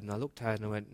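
ordinary talk, nothing marked

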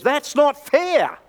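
high, slow indignant outburst. Retold or imitated. Young soldier protesting to the padre, indignant. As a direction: hurl it back like a wronged soldier. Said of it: "a tone of indignation".

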